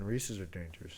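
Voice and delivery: muffled behind a mask; through a face mask. Real, not acted. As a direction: talk as if under a mask. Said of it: "talking through a mask?".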